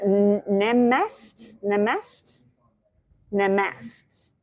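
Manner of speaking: Nemast said as two syllables, rhyming with "best"; unsure pronunciation. Real, not acted. Host sounding out a greeting for Indian viewers, unsure pronunciation. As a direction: try out Nemast with a questioning lift, no final "ay". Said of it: "how do you pronounce it?".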